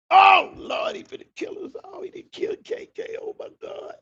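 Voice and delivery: high, hushed, frantic terror; hiding from a shooter, breathless fear; panicked whisper. Retold or imitated. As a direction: whisper fast like your life depends on it.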